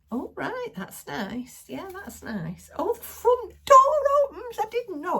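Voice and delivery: high, sing-song imitation of an unimpressed man; mocking husband. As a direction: send up the husband's polite disinterest. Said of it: "And he's like".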